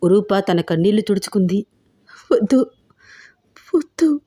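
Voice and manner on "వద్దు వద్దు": higher, scared tearful no; terrified girl pleading; frightened crying. Acted. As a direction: beg in fear, voice rising. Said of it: "అంది భయపడుతూ, ఏడుస్తూ".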